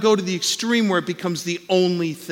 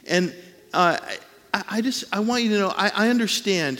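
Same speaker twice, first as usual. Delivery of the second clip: normal talking voice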